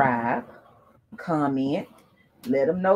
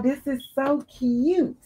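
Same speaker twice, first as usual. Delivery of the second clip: usual speaking voice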